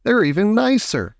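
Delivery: high-pitched eager boast; Mister Wonka crying out. A narrator's reading. Host recommending his buttercups, keen cry. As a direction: pitch it up with enthusiasm. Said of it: "cried Mister Wonka".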